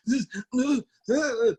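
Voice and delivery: very high strangled mock sobs; weird crying noises. New dad at daughter's birth, imitated high weird crying noises. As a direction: imitate helpless, wordless crying squeaks. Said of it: "I made some weird noises".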